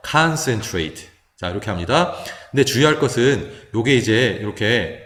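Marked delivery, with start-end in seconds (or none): 0.1-1.1 concentrate very slowly, first syllable "kahn"; stressed "a" vowel. Shown as a demonstration. Teacher modelling a stressed vowel, slow concentrate opening on "kahn". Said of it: "강세가 있기 때문에 아로 발음하죠".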